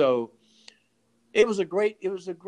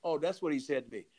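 ordinary delivery

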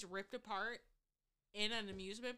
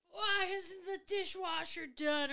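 high, loud, slow mock dad yell; imitating angry dad; 9 semitones higher, 7 dB louder, slower than usual. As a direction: play the grumpy father coming home. Dad coming home yelling.

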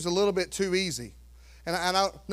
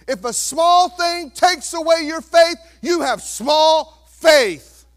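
slow, much louder shout; preaching shout; 6 semitones higher, 9 dB louder, slower than usual. Real, not acted. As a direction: hammer small faith home. Preaching climax.

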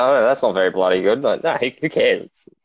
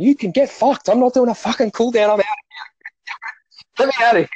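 much higher, indignant refusal; hammed-up protest, much higher; mock outrage; 12 semitones higher than usual. Exhausted rider refusing the cool down, high mock outrage.

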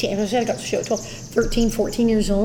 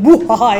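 villain laugh; 8 dB louder than usual. Retold or imitated. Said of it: "relishing of".

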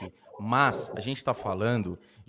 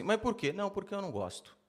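mock exchange; 9 semitones higher, 5 dB quieter than usual. Acted question and picky lawyer's answer.

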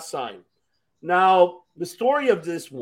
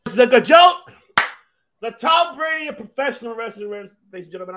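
big booming ring-announcer hype, much higher and louder at the start; 8 semitones higher, 7 dB louder than usual. Pretend. Podcast host hyping a wrestler to his co-host, announcer style. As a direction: sell him like a ring announcer introducing a star.

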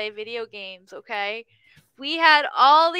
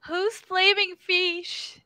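calling across a room; 5 semitones higher, much slower than usual. Retold or imitated. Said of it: "somebody across the room goes".

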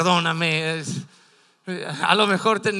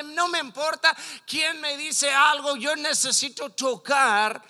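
higher-pitched, mildly desperate insistence; desperate determination; 5 semitones higher, 3 dB louder than usual. Retold or imitated. Preacher voices a woman pushing through the crowd, desperately determined. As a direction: act out her urgent resolve. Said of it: "bien desesperada".

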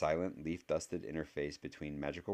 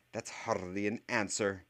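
sharp, higher and louder snap; snapped retort; 4 semitones higher, 5 dB louder than usual. A narrator's reading. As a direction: snap it back at him. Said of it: "snapped Professor McGonagall".

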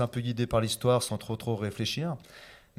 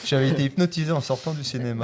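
chuckling, a bit louder; laughing through words; 3 semitones higher, 6 dB louder than usual. Real, not acted. Guest laughing as he jokes about the film hypnotizing him. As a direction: let the laugh spill over the line.

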